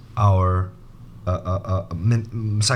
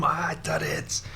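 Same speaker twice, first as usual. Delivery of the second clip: slow, much softer childlike Duddits impression; imitating Duddits; 3 semitones higher, 9 dB quieter, slower than usual. Teacher imitating Duddits from Dreamcatcher, slow and soft. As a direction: say it slowly and quietly like the alien kid. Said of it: "He goes".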